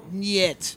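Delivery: "nyet" drawn out with a marked Y glide; extra Y sound. Shown as a demonstration. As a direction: stretch "nyet", leaning on the ny. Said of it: "You need more Y's".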